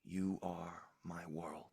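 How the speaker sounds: slow whispered declaration; tender whisper. Acted. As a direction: breathe it softly to a lover. Loving last words.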